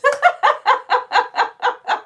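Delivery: long, high, loud staccato cackle; high cackling laugh. Real, not acted. Host delighted pupils will test theme park ideas, high cackle. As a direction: cackle loudly in high bursts.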